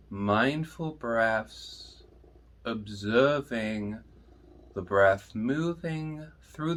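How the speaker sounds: soft, slow meditation voice with long pauses; guided meditation. Acted. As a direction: guide listeners gently inward, hushed and unhurried.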